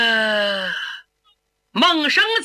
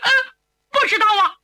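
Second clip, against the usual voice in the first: high, choked denial; tearful; 11 semitones higher, 4 dB louder, much faster than usual. Acted. Son questioned about the stolen weapon, near tears. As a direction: whimper out the denial. Son pressed again, distressed.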